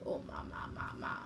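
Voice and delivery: whispered mama. Shown as a demonstration. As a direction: whisper the syllables to the dog.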